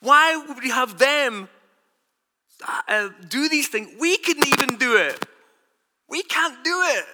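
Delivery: a hint of exasperated elders; mildly voiced complaint of burdened men; apostles' protest. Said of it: "They're saying, like".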